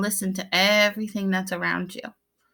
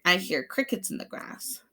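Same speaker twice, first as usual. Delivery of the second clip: usual speaking voice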